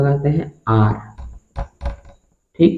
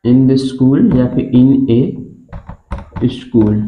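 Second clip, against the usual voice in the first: words spaced out very slowly; slow dictation; much slower than usual. Shown as a demonstration. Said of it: "ये हम लिख लेते हैं".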